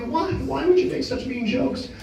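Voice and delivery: put-on a bullied boy asking the other kids why; hurt little boy. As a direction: plead like a teased kid.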